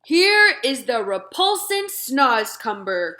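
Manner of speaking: slow, loud showman's cry. Acted. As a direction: present the vile thing with a flourish. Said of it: "cried the B F G".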